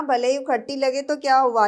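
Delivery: ordinary delivery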